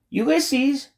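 high-pitched calling shout; moderately loud, high holler. A narrator's reading. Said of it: "shouted Tootie".

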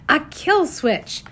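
slow, high, thrilled discovery; excited exclaim. A narrator's reading. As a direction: announce the find with a gasp of delight.

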